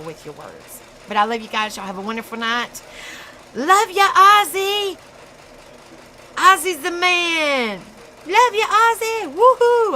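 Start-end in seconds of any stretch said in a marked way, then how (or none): 3.5-9.9 high, loud, whooping holler; excited cheering. Real, not acted. Streamer cheering Ozzy at sign-off, excited holler. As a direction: holler it out with drawn-out glee.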